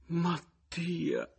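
high, drawn-out gasp of the name; shocked woman's thin cry; fainting cry. A narrator's reading. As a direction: cry it out as if swooning. Woman cries out, then faints.

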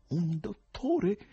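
high, very drawn-out query; stretched, high-pitched inquiry; questioning call. A narrator's reading. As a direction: ask it high and slowly. Someone is heard asking for doctor.

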